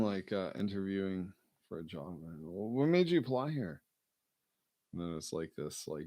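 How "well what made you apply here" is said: high, loud, formal interviewer question; mock interviewer. Friend imitates an interviewer asking why he applied. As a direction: ask it like a stiff hiring manager.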